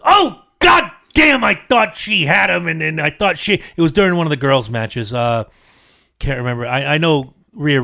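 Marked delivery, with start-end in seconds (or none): 0.0-2.7 high, loud, dragged-out groan of disappointment; daughter's frustrated outburst. Retold or imitated. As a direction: mimic a kid furious her wrestler lost. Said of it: "She goes".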